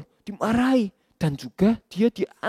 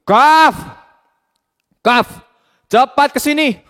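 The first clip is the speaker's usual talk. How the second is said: loud, high call; shouting; 6 semitones higher, 10 dB louder, much slower than usual. Acted. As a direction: yell his name across the yard. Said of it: "Biar aku saja yang panggil".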